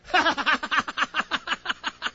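high, sustained run of laughter; amused high cackling laugh; real laugh. Real, not acted. Laughs at a listener's reaction.

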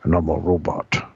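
put-on deep old man voice; Wilford Brimley voice. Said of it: "Walter Brimley".

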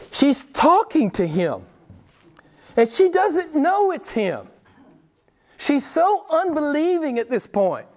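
high, drawn-out disbelief; incredulous. Real, not acted. Preacher marvels at Mary not recognizing Jesus, incredulous. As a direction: marvel slowly, voice pitched right up.

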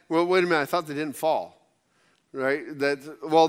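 ordinary delivery

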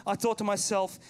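ordinary talk

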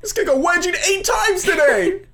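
mock nerdy kid. Mocking a kid's blog post.

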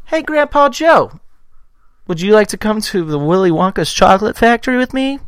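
put-on sweet little boy calling grandpa; child voice. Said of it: "you'll just be like".

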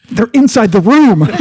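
sudden loud burst of fake alarm; mock horror. Friend discovering the pursuers inside, mock horror. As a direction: break from the whisper into pretend terror. Pretend horror after whispering.

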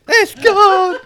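high loud crying wail; anguished wail. Acted. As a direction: wail it out in mock grief. Comic wail at loss.

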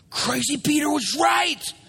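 high, hurried excited shouting; amazed crowd. Acted. Shore crews rushing to join Peter, amazed. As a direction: cry out the news, then rally everyone. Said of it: "all the other crews are like".

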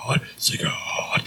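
slow, voiceless chant; imitated eerie whisper. Imitating the opening of Hell Awaits, whispered. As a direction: whisper it like an eerie album intro.

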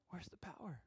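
unvoiced whisper; soft whispered plea. Real, not acted. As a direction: ask it in a hush. Whispered question.

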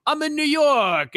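New York tough guy. Retold or imitated. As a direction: swagger like a New Yorker. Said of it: "a dude who's like".

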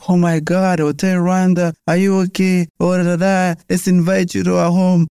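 high, overdone sympathetic voice; mock pity. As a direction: ham up the tearful do-gooder. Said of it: "now everybody start crying for you".

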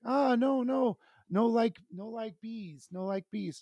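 put-on high, halting baby talk; imitating toddler. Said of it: "She's able to speak at this time a very, very little bit".

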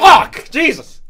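sudden high yell of alarm; startled shout. Real, not acted. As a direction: jump and yell, genuinely startled.

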